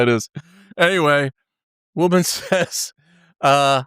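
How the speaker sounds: ordinary talk, nothing marked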